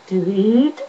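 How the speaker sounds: high-pitched robot voice; Cyberman. Shown as a demonstration. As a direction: lift the pitch and end on a rise. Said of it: "Cyberman with a high pitched voice".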